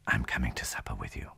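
low, hushed aside to a friend; undertone. A narrator's reading. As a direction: say it softly, under your voice. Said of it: "he added in an undertone".

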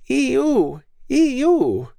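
put-on very high squeaky baby chant. A narrator's reading. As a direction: chant it like a tiny toddler. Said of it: "in his squeaky baby voice".

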